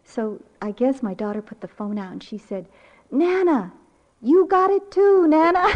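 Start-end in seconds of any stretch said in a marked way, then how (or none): 3.1-5.7 put-on high, loud little-girl cheer; imitated child's yell. Granddaughter yelling to Nana through the phone, imitated yell. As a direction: holler it gleefully like a small child. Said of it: "She was yelling".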